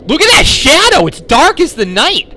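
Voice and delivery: high, loud exclamation; loud amazement. Real, not acted. Player marvelling at a game shadow, loud amazement. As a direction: shout in over-the-top astonishment.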